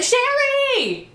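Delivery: high, drawn-out call across a crowd; retold yelling. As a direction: imitate a friend hollering her name. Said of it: "the friend is yelling".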